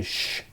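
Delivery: soft, drawn-out shushing noise; a quick "shh" to keep it secret; imitated hush. Imitating experts hushing others.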